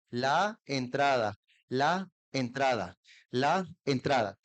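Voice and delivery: "la entrada" said very slowly, each sound clear; slow careful classroom drill; slow and well articulated. Shown as a demonstration. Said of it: "lentamente y bien articulado".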